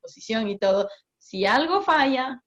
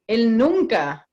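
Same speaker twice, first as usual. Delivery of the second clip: ordinary delivery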